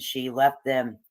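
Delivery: ordinary delivery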